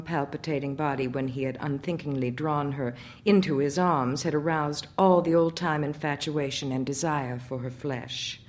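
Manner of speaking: usual speaking voice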